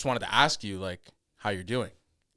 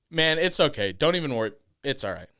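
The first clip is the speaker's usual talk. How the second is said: higher, louder friendly reassurance; lifted, louder friendly check-in; upbeat reassurance; 6 semitones higher, 7 dB louder than usual. Retold or imitated. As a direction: brightly brush it off and check in. Reassuring singer in retold scene.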